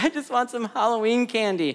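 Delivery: put-on child's tearful plea; whiny crying son. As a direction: imitate your kid whining for a treat. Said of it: "belly aching and crying about it".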